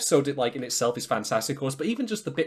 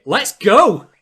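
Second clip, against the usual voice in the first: much louder, higher battle cry; rallying shout; 8 semitones higher, 13 dB louder than usual. Acted. Animator rallying the team, in a big excited shout. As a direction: holler it to launch the team.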